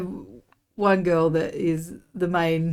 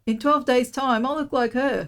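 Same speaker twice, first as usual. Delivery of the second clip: high-pitched, lightly acted punchline; playful retold joke; 6 semitones higher than usual. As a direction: re-enact the cheeky line up high. Acts out their running joke.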